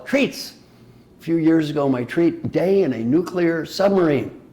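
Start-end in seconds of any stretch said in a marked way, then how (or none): 0.1-0.5 high squeaky delighted Treats; playful repeat. Real, not acted. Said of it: "jokey repeat of treats".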